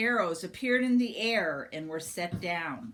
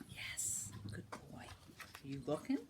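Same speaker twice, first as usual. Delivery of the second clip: whispered aside; 4 semitones lower, 13 dB quieter than usual. Real, not acted. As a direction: murmur it quietly to a little one. Hushed aside to child.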